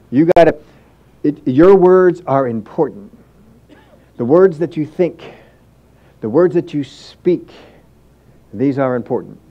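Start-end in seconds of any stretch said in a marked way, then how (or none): 1.2-7.5 slow, weighty stress on "words"; slow emphatic. Real, not acted. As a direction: lean on each "words", unhurried. Preacher drives point home.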